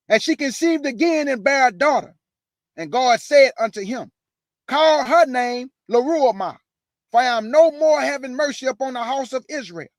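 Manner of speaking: loud, declaiming scripture read. Acted. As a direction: proclaim the verses to the congregation. Reads scripture aloud.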